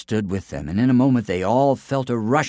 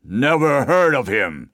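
slow, louder dismissive giant; unbothered giant; scornful giant; 5 semitones higher, 5 dB louder than usual. Acted. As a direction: shrug it off. Giant unimpressed.

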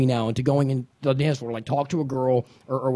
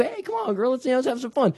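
much higher, softer re-enacted plea; playful coaxing; 9 semitones higher, 5 dB quieter than usual. Man coaxes a girl to dance, high and playful. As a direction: replay yourself coaxing her in a high voice.

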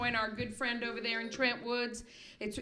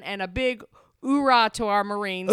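loud gung-ho soldier; 13 dB louder than usual. Real, not acted. Rallying hoorah to Marines.

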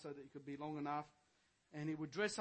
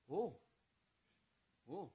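normal talking voice

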